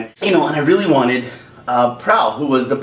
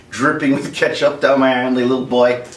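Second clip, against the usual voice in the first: usual speaking voice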